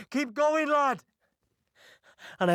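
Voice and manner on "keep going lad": high-pitched yell from behind, urging him on; retold battlefield shout; urgent shout. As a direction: shout it as an order to keep moving. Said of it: "this voice from behind shouting".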